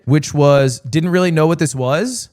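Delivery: normal talking voice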